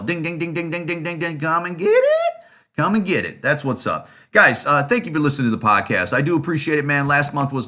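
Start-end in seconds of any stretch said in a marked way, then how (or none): 0.0-3.4 high singsong ding ding call; dinner bell call. Retold or imitated. Host mocking accusers as a dinner bell call, in a high singsong.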